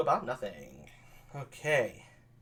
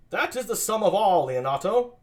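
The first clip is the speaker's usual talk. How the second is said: loud, higher princely voice; a prince greeting his host, loud and high; Don Pedro; 6 semitones higher, 6 dB louder than usual. Acted. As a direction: announce it like a prince.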